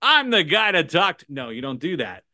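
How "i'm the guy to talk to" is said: high, loud, overblown sales pitch; over-the-top salesman. Shown as a demonstration. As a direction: boom it out too eagerly, pitched up. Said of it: "I'm not gonna go".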